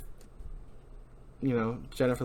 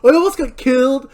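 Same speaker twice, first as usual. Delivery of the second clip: high, loud hammed-up whining; host parodying Barry's jacket obsession; mocking Barry; 11 semitones higher, 11 dB louder than usual. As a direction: overact the petty grudge. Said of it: "It's like".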